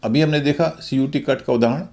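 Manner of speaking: ordinary talk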